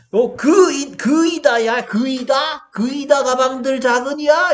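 높고 크게 어이없어하며 되묻기; 답답해 돌아버린 선생님을 과장해서 재연. Retold or imitated. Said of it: "내가 빡 돌겠어, 안 돌겠어?". (빡 돌아서 높게 소리치며 따지기)

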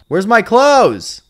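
high, loud and slow, caught out; startled cry. Real, not acted. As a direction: yelp it out in shock. Finds his character naked.

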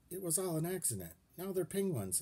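flustered. A narrator's reading. Uncle panicking over lost kids.

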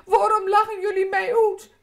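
high, loud indignant little kabouter; angry child. Acted. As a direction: protest hotly at being laughed at. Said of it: "En Pim wordt dan kwaad".

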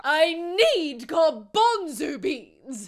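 very slow dopey drawl. Acted. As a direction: stretch every word dopily. Impression request video.

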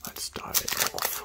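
slow, breathless whisper. Real, not acted. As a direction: whisper it as if the pack might hear. Repeated as a whisper.

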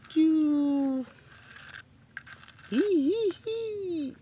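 squeaky high vroom; high toy engine squeal. Pretend. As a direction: make a tiny bike zoom past. Toy motorbike noise.

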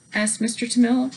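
plain reading voice

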